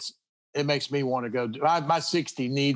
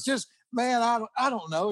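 higher, mildly annoyed shrug; a touch of weary annoyance; annoyed Jerry; 6 semitones higher than usual. Retold or imitated. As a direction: imitate a fed-up guitarist brushing it off. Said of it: "Jerry finally got kinda annoyed".

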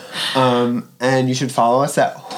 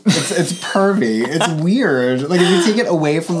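ordinary talk

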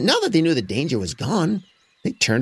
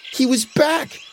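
dramatic; 13 semitones higher than usual. A narrator's reading. Narrator dramatic reveal.

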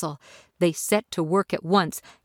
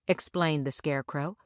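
plain reading voice

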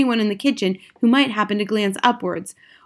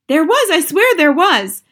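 high, loud protest; shouting back; 7 semitones higher, 6 dB louder than usual. A narrator's reading. Cook swears the mouse was there, shouting back. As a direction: insist at a yell. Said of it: "the man was shouting back".

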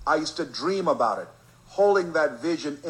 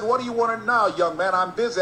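higher, louder, annoyed retort; irritated boss; 8 semitones higher, 4 dB louder than usual. Retold or imitated. Disc jockey snaps at the persistent kid, higher and louder. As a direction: brush the kid off, impatient and loud.

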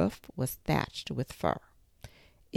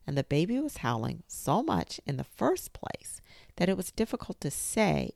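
ordinary delivery, nothing marked

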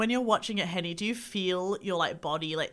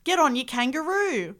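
mock Aussie cliché; 7 semitones higher, 5 dB louder than usual. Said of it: "things international people think we say".

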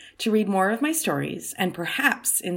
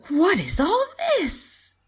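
high, louder puzzled question; surprised mom; 13 semitones higher, 5 dB louder than usual. Acted. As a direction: act delighted surprise. Mommy surprised at door.

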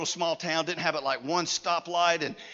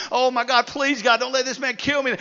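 loud, slightly raised mock cry; retold screaming plea; 7 semitones higher, 11 dB louder than usual. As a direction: act out a terrified woman begging God. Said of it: "She is screaming".

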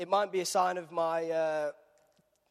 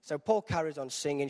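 ordinary talk, nothing marked

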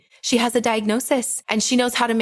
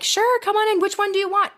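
much higher, sing-song accommodating nurse; eager nurse; 12 semitones higher than usual. Retold or imitated. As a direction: chirp it like a nurse eager to please. Staff welcoming both into the room.

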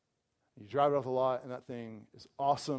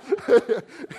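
loud burst of laughter; 9 semitones higher, 14 dB louder than usual. Real, not acted. Laughs at absurd scenario.

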